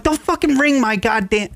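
high-pitched retold outburst, not shouted; infuriated. Host retells his reaction to the doorbell, mock infuriated. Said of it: "how fucking infuriated I would get".

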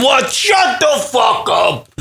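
put-on slow, high grumpy old spouse snapping; grumpy bickering snap. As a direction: bark it like a fed-up old husband. Old couple bickering for fifty years.

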